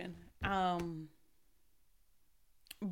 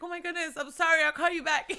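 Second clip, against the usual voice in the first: higher, louder flustered friend apologising; imitating her friend, higher and louder, hurrying off the phone; worried friend; 9 semitones higher, 8 dB louder, faster than usual. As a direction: play the friend who hangs up fast, startled and sorry. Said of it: "She's like".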